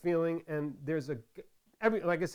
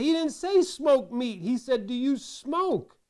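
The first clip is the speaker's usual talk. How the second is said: exasperated; 7 semitones higher, 5 dB louder than usual. Retold or imitated. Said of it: "I said".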